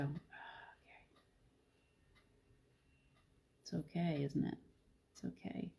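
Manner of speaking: whispered, breathy calm to herself; soft whisper. Pretend. Whispering to herself as worry falls away, hushed and calm. Calming inner voice, letting go.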